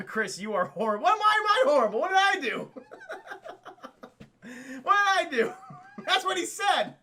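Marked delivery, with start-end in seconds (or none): 1.0-2.7 high, loud, laughing indignation; laughing protest. Real, not acted. Streamer answering a viewer's jab, laughing protest. 4.8-6.9 high, loud, laughing indignation; laughing protest. Real, not acted. Streamer answering a viewer's jab, laughing protest. Laughing defence of own impression.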